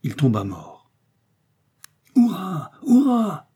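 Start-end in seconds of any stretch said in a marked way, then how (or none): 2.2-3.5 high, loud triumphant cheer. A narrator's reading. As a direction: cheer the kill, climbing higher. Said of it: "s'écria Joey".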